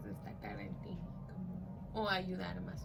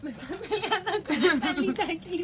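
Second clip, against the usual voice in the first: laughing through the words; 8 semitones higher, 8 dB louder than usual. Real, not acted. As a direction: laugh as you tease her. Laughing while teasing friend.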